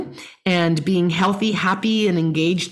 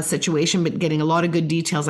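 ordinary talk

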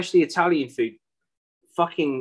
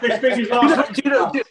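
laughing, higher and louder; 9 semitones higher, 6 dB louder than usual. Real, not acted. Still laughing while starting a new story, laughing. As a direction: laugh as you start the line.

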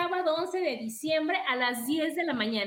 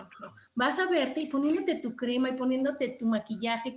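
usual speaking voice

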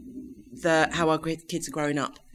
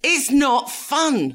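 exasperated; 7 semitones higher, 4 dB louder, much slower than usual. Real, not acted. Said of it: "How many times have I said that".

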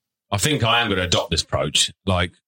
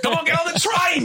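high urging. Retold or imitated. Dad urging demon son.

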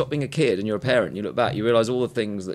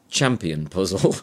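northern accent; 6 semitones lower, slower than usual. Shown as a demonstration. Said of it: "in a northern".

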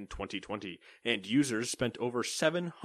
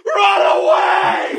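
very high, slow, screamed run away; shrill shout; 18 dB louder, much slower than usual. Retold or imitated. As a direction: shriek it like a panicked cartoon. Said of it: "you just hear".

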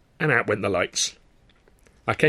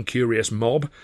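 ordinary delivery, nothing marked

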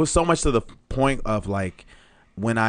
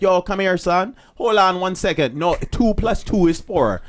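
put-on raised-pitch Jamaican accent; 5 semitones higher than usual.

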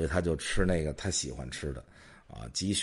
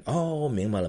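sudden realization; 7 semitones higher, 5 dB louder than usual. Retold or imitated. Clerk realizes again in joke.

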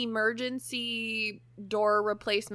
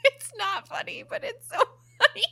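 high, breaking laugh through the words; laughing so hard it nearly turns to tears; laugh-crying; 3 dB louder than usual. Real, not acted. Telling cohost about the wrecked garage door, laugh-crying. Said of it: "genuinely think it's fucking hilarious".

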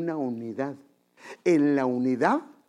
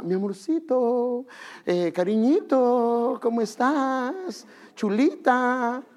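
high, slow mock-tender cooing; syrupy sweet talk; 9 semitones higher, 3 dB louder, much slower than usual. Husband putting on sweetness for his wife in public, slow high cooing. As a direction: drawl the pet names like a too-sweet husband.

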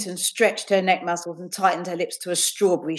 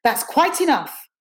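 higher, slow, firm teacher's rebuke; mildly raised scolding; attempt at raised voice; 7 semitones higher than usual. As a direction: draw it out to rein in a pupil. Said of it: "an attempt at raising her voice".

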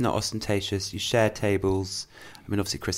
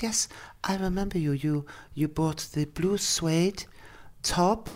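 Austrian shop lady; 7 semitones higher than usual. Retold or imitated. Narrator quoting the Austrian shop owner remembering the top. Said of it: "She goes".

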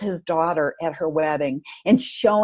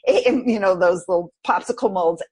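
ordinary talk, nothing marked

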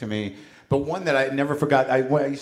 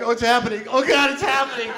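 mock tearful breakthrough; 9 semitones higher than usual. Joking about therapy to friends, hammed-up breakthrough. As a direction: ham up a patient about to cry.

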